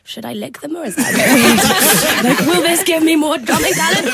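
giggling through a retold thought; laughing at her own silly idea.

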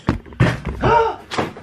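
high, sharp intake of breath; shocked gasp. Pretend. Shock before complaint about camera.

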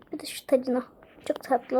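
soft whisper to herself. Real, not acted. Eyeing the crown she loves, soft whisper. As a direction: breathe it out as a secret.

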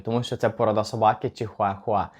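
ordinary talk, nothing marked

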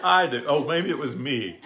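laughing it off, loud and bright. Real, not acted. Laughing as his wife denies his story. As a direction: chuckle through the confession.

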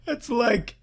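high, slow laugh-cry; laughing despair. Real, not acted. Player bemused by the poem, laughing almost crying. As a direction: whine it in a half laugh.

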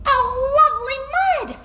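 very high, excited squeal; delighted duck. A narrator's reading. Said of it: "said the duck".

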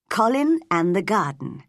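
usual speaking voice

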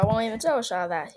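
ordinary talk

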